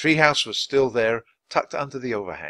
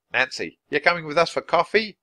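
yelling Tony; 6 semitones higher, 4 dB louder than usual. A narrator's reading. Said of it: "Tony yelled".

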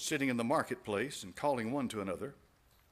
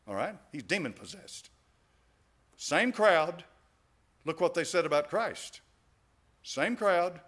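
ordinary talk

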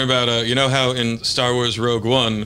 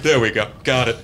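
normal talking voice

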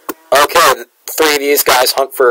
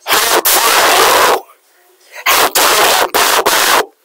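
higher, slow, breathy mock outrage; shaking with anger; 8 semitones higher, slower than usual. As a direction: gasp it out in hammed-up fury. Repeats outrage, breathy and high.

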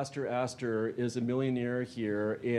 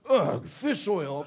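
slow, louder, higher disgusted "ugh"; disgusted groan; 6 semitones higher, 5 dB louder, much slower than usual. Acted. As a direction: recoil at the smell of fish oil. Mobster disgusted by fish oil.

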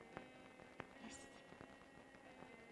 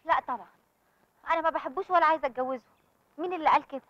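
raised voice, protesting hard; loud heated denial; 4 semitones higher, 9 dB louder than usual. Acted. Sister loudly denies loving Hossam, heated protest. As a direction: push back loudly, stung by the accusation.

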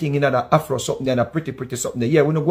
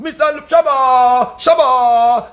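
very high, loud, drawn-out Shabba calls; mock [name] shout; 12 semitones higher, 5 dB louder, much slower than usual. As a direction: shout the name like a comedy crowd. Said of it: "them a mock [name]".

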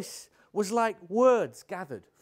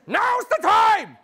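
slow, high, full-volume yell; exasperated, drawn-out scream; urgent shout; 11 dB louder, slower than usual. Acted. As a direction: bellow it to end the dithering. Exasperated order to hand over brandy.

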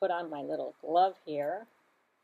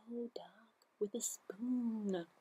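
slow exasperated whisper; whispered hush; 2 semitones higher, 8 dB quieter, much slower than usual. Acted. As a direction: hush the pesky duck. Pig and Mouse hush Duck.

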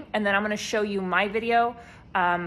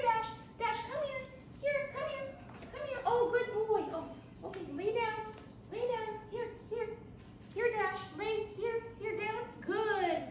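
much higher, softer nonstop puppy coaxing; calling a lost pet; 9 dB quieter than usual. Shown as a demonstration. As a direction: coo and fuss at a puppy. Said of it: "it'll look something like this".

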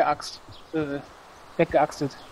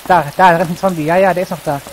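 alarmed shout; 2 semitones higher, 11 dB louder than usual. Real, not acted. Zombie spotted, alarmed call.

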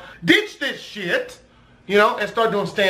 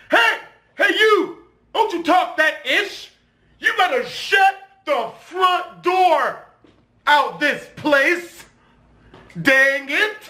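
higher, hammed-up scolding with cleaned-up curses; mock clean-cursing scold; 5 semitones higher, slower than usual. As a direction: play a prim scold yelling fake curses. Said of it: "most creative curse words".

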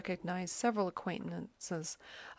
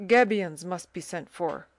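a firm, stern order, louder; firm sergeant cutting in, louder; 8 dB louder than usual. A narrator's reading. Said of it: "said he sternly".

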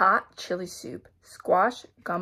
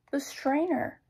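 high-pitched acted announcement of an idea; high storybook eureka; sudden idea; 7 semitones higher than usual. A narrator's reading. Sudden idea, then questioned.

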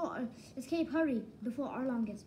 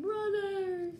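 mock crying; 8 semitones higher, 3 dB louder than usual. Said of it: "Arlong is like crying".

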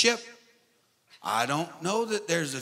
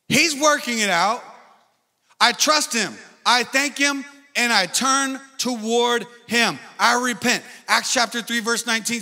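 loud, higher declarations; preaching; 6 semitones higher, 5 dB louder than usual. Acted. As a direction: preach each line like a vow. Preaching at full force.

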